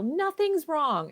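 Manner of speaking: high, drawn-out imitation yell; mock child's yell. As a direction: play a kid yelling back, without real volume. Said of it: "Or they yell".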